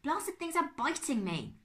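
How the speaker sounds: high, slow, loud complaint; pained annoyance. A narrator's reading. Uncle Max bitten in the trap, pained annoyance. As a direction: complain as you are bitten.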